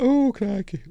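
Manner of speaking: mock high exclaim. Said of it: "so she's like".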